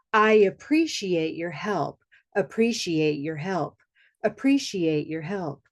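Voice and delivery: steady, rhythmic drill chant; call-and-repeat drill. Acted. Instructor leads students in a timed drill, rhythmic and steady. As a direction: lead the group in a rhythmic repeat.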